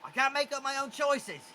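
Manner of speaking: a confused charmed goblin begging for orders; the goblin nervously asks Quetzal what to do. Acted. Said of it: "he looks to Quetzal".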